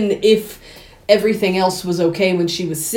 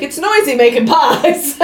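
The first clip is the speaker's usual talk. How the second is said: higher, louder and a touch too cheery, a lightly voiced Mrs Lovett; innocently brushing off the noise, lightly put on; mock innocent Lovett; 6 semitones higher, 4 dB louder than usual. Mrs Lovett explaining the banging to Toby, a breezy mock innocent voice.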